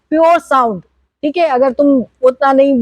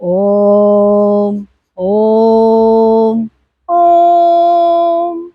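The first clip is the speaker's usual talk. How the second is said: Om on three notes; 3 semitones lower, much slower than usual. Shown as a demonstration. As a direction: climb "ओम" over three notes. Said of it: "एक middle note है".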